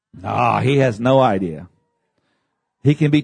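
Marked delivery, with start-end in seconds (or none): none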